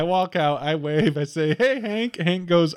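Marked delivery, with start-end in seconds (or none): 1.5-2.2 high, cheery mock hello; neighborly greeting. Walking out and waving to Hank, cheery mock greeting. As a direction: greet the neighbor in a singsong pitch. Acting friendly wave to neighbor.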